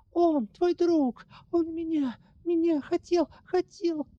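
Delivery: high, slow, broken frightened voice; frightened stammer. Acted. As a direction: stammer it out in fear.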